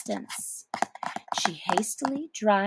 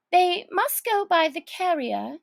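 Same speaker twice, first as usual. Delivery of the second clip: put-on high girlish thinking aloud; lightly voiced Alice planning; Alice musing; 7 semitones higher than usual. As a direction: puzzle it out like a child.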